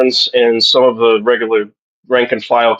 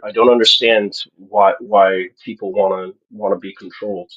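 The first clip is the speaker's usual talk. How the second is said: usual speaking voice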